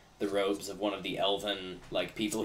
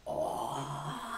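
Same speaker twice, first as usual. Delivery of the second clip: awed goblins; 5 semitones higher, 7 dB quieter than usual. Acted. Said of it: "the goblins are like".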